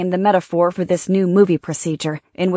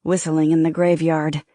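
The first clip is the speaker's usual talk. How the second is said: usual speaking voice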